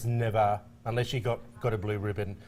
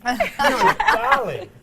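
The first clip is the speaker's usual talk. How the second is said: gushing; 6 dB louder, much slower than usual. Real, not acted. Thanking intern for gift.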